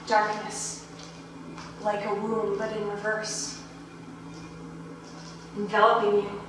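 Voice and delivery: hushed, unhurried trance-like narration; soft, spacious meditative voice; guided meditation. Acted. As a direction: guide them gently into the dark, with long pauses. Leads listeners through remembering death.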